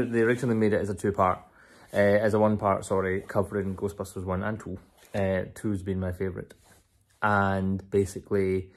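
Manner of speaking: ordinary talk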